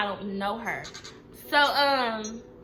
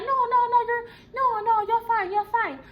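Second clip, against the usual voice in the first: much higher, sweetly reassuring mock voice; imitating the manager; 10 semitones higher than usual. Manager reassures the fired worker, in a high mock-sweet voice. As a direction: squeak it out like a nervous boss smoothing things over. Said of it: "She, she was like".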